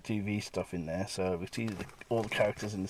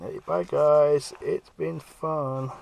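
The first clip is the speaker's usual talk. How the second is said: slow, louder, higher teasing farewell; cheeky goodbye; 5 semitones higher, 6 dB louder, much slower than usual. Retold or imitated. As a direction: taunt them as you shut the door. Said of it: "him through the door going".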